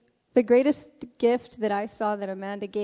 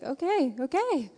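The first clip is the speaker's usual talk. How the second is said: each okay higher and more nervous; a rising nervous okay okay okay; 6 semitones higher than usual. Retold or imitated. Nervous Andrew agreeing to everything.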